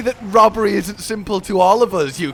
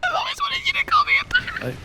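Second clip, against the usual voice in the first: high, breathy whisper with no air; squeaky dying voice; faster than usual. Acted. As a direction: squeak it out like you're fading. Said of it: "speak to me in a squeaky voice like you're dying".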